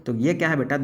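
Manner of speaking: ordinary talk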